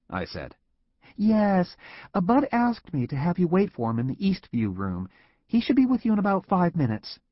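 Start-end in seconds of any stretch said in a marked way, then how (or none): 1.2-7.2 lightly voiced, higher young secretary; a hint of a cheerful receptionist; friendly secretary. A narrator's reading. Secretary telling Tom to wait, lightly voiced and friendly.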